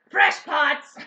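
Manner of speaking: slow, very loud holler; shouted catchphrase. Acted. Host jokingly pitching a catchphrase, hollering. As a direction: bellow it like a catchphrase.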